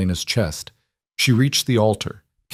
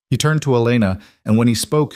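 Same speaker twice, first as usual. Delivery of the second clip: usual speaking voice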